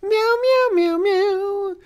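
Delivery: very high squeaky meowing; cat meows. Pretend. Random interruption in the vlog, high playful meowing. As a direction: meow like a playful kitten.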